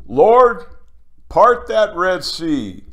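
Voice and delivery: loud high prophet's cry, slowed; Moses command. Retold or imitated. Imitating Moses calling on the Lord, loud. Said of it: "like Moses parting the Red Sea".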